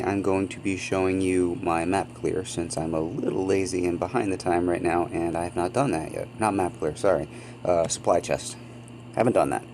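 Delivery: ordinary delivery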